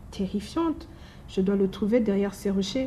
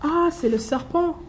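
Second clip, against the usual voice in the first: high, bright call out; excited announcement; 8 semitones higher than usual. Acted. The mouse points out the snake to the gruffalo, excited. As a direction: point him out with glee.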